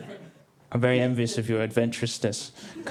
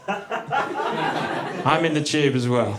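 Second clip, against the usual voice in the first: flat, slow deadpan; much slower than usual. Retold or imitated. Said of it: "was like".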